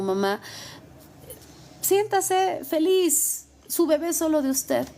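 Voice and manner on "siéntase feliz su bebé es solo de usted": very slow, higher and tender; reassuring. Real, not acted. Lawyer comforting single mothers, slow and tender. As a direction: stretch it out gently to comfort.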